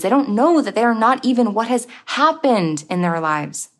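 ordinary delivery

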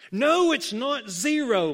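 loud, high, heated denial; hot retort. A narrator's reading. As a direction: snap back in protest. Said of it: "Muggs reported hotly".

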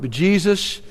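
soft, low, hushed narration; tender storytelling with gentle pauses. A narrator's reading. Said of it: "What a tender moment".

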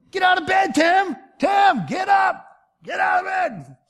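very high, loud shout; imitated wake-up call, shouted at full volume. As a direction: holler at a sleeper to get up. God yelling to wake him.

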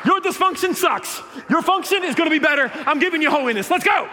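a new sheriff busting in; Jesus as a pushy lawman, loud and high; forceful Jesus. Retold or imitated. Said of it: "I want, "Behold".

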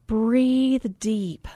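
slow soothing whisper; zen whisper. Retold or imitated. Instructor guiding her through relaxation, slow hushed whisper. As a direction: breathe the words out like a meditation guide. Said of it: "She's like".

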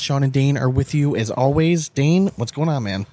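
usual speaking voice

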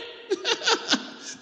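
high airy burst of laughter; breathy laugh. Real, not acted. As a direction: laugh at your own joke. Laugh after punchline.

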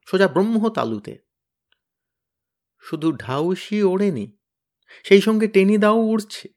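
ordinary delivery, nothing marked

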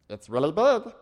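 high, squeaky little ant; talking ant describing the painting. Pretend. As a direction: play a tiny ant, squeaking. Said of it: "That's my ant voice".

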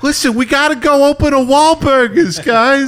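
high, pushy excited voice; mock Wahlberg urging. Parodying a Wahlberg movie scene, high pushy voice. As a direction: urge the guys like a goofy movie hero. Mocking Mark Wahlberg character.

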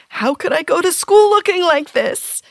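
put-on high, panicked wail of a teenager; mock despair, like the worst day of your life; teen panic over bad bangs. Teen girl to herself over her hair, panicked. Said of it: "and panicked".

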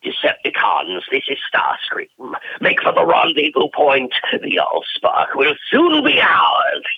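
rasping robot villain with a light British accent; Starscream voice. Shown as a demonstration. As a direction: sneer the order like a scheming robot commander. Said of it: "flavor of Chris Latta in there".

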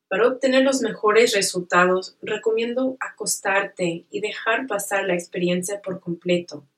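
ordinary talk

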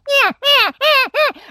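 high yipping whine like puppies; elk cow whine. Shown as a demonstration. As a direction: squeal out a quick cow mew. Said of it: "they're whining puppies out there".

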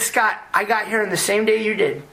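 mock-lecture a doubting medic; exasperated retort. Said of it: "And I said".

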